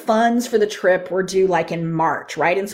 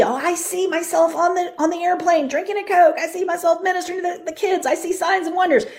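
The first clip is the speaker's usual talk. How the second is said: put-on higher, bright child voices sharing visions; excited kids' voices; 7 semitones higher than usual. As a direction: pitch up like eager children. Kids voiced describing their visions.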